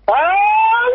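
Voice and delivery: a high, exaggerated ironic "ah"; mock surprise. Ironic reply to colleague's plan. (sorpresa irónica exagerada)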